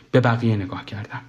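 usual speaking voice

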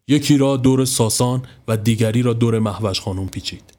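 ordinary delivery

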